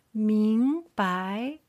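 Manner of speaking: 明白 with both syllables rising slowly; two second tones. Shown as a demonstration. Teacher showing second tone pairs, 明 and 白 both rising. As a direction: lift 明, then lift 白 the same way. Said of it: "second line for the second tone".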